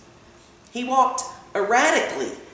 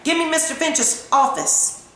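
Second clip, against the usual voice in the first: higher, mildly shouted demand; shouting into phone; 7 semitones higher than usual. A narrator's reading. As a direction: call out urgently down the line, pitched up. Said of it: "went to the telephone and shouted".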